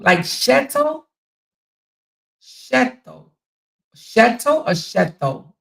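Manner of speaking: "Shetto" slow and high, starting with SH; sounding out "Shetto" with a hushing first consonant; CH pronunciation. Shown as a demonstration. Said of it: "more like C H".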